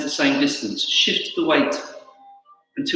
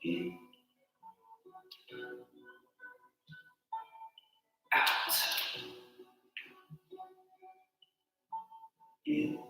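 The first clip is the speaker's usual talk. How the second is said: calm exercise cues; 10 dB quieter than usual. Acted. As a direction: guide the breath gently. Guides breathing and stretches.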